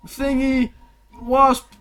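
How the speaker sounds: high, slow, loud fight intro; arena announcer. Acted. Game master introducing a fighter to the arena, booming announcer. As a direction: draw the name out for the crowd. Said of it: "this announcement, by the way".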